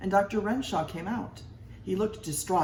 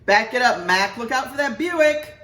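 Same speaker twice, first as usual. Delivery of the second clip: much louder, higher parking attendant; yelling attendant; 8 semitones higher, 9 dB louder than usual. A narrator's reading. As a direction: shout at a careless driver.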